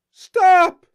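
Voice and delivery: high, loud, crying scream; screaming through tears at the train; tearful scream. A narrator's reading. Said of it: "Miss Shaw cried again".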